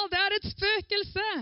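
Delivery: high, slow terrified cry; mock panic, a touch hammed-up; frightened cry. A narrator's reading. Disciples see a figure on the sea: frightened cry. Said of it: "ble de slått av skrekk og sa".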